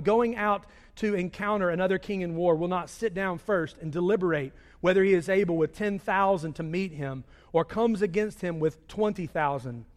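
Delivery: normal talking voice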